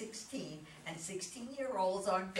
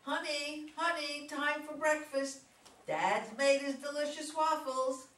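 high, loud sing-out call; raised calling voice, pitched high; shouting upstairs; 9 semitones higher, 6 dB louder than usual. Acted. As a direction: call a child down to breakfast. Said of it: "shouts up".

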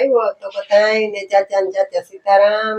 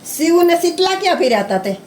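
high-pitched cooing question; 6 semitones higher, faster than usual. Real, not acted. Baby-talk questioning to little child.

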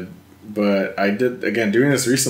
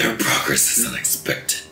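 rasping, voiceless villain growl; harsh K. Rool villain voice; throat-straining villain voice; 2 semitones lower, 4 dB quieter than usual. Acted. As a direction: scrape it out from the throat like a game villain. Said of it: "not pleasant on my throat".

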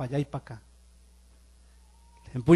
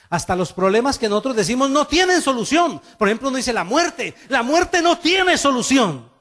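full-force preaching; 9 semitones higher, 7 dB louder than usual. Acted. Pastor preaching hard to the congregation. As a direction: preach it out, rising to a near shout.

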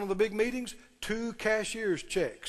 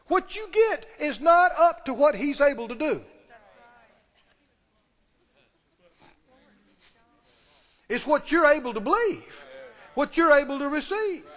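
preaching force; 5 semitones higher, 4 dB louder than usual. Acted.

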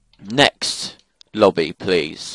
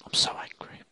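whispered, suppressed anger; angry whisper; 11 dB quieter than usual. Real, not acted. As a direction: seethe it under your breath. Seething quietly after game trouble.

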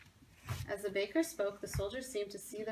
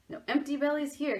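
louder, proud declaration; firm promise; 5 semitones higher, 7 dB louder than usual. A narrator's reading. Baker promising General Washington, proud and loud. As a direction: say it like a vow. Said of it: "he told General Washington".

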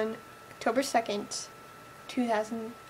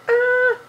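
high squeal of joy; excited scream; 12 semitones higher, 10 dB louder than usual. Real, not acted. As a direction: scream with delight. Screaming at being followed back.

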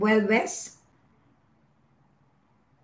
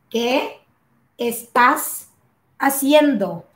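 "qué ... estás ... haciendo" broken up with pauses; word by word. Shown as a demonstration. Teacher breaking the question down for learners, word by word. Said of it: "how to use this".

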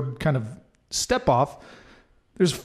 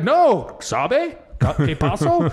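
imitated Mexican friend; 3 semitones higher, much slower than usual. Imitating Mexican friend who missed the coyote, Mexican accent. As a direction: lightly play the puzzled Mexican buddy. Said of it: "Harry's a Mexican friend of mine".

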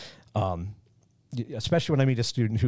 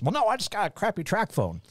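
higher-pitched put-on country Southern voice; mock Southern accent; 9 semitones higher than usual. Man admitting he has no video, mock Southern accent. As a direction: answer in a caricatured Southern accent. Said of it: "I go right to that accent".

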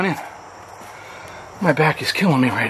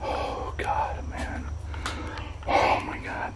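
frightened whisper; 12 dB quieter, much slower than usual. Real, not acted. Frightened after calling spirits.

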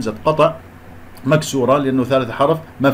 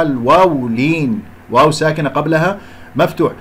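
usual speaking voice

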